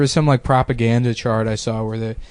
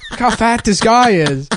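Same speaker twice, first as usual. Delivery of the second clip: put-on higher, eager child pointing out a man; excited little kid; 9 semitones higher, slower than usual. Boy voices himself as a kid to his mom, higher and eager. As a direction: play a blunt five-year-old. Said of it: "I was like".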